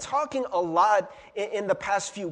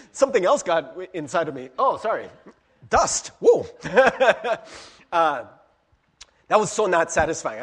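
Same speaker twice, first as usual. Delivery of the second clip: normal talking voice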